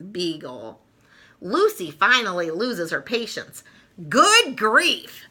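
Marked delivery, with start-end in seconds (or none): 4.1-5.2 higher, louder good grief; exasperated cry. Acted. Lucy fed up with Linus's Easter Beagle talk, exasperated cry. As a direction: lose patience at last. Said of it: "she cries".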